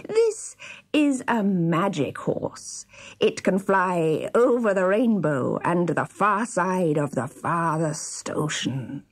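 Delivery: slow, sly villain voice; evil-grinning dwarf. A narrator's reading. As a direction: draw it out with an evil grin. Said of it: "said the dwarf with an evil grin".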